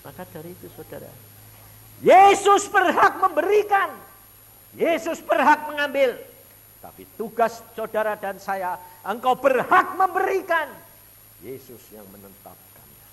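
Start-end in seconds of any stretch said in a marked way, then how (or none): 2.0-10.7 loud, high preaching at full force. Acted. Preacher proclaiming to the congregation, loud high preaching. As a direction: proclaim it to the church.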